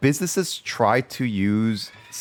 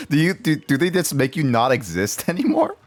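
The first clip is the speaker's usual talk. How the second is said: laughing; 9 semitones higher than usual. Real, not acted. Laughing at scam call story.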